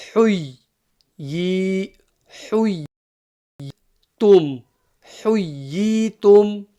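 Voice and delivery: huy with a crisp ha from the middle of the throat. Shown as a demonstration. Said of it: "the ha should come crisp from the middle of the throat".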